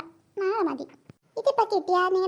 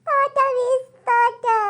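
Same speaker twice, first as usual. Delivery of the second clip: hammed-up wail, louder toward the end; mock sobbing that bursts into a loud cry; crying student; 3 semitones higher, 6 dB louder than usual. Student to teacher fearing she failed, hammed-up crying. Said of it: "അയ്യേ, കരയല്ലേ".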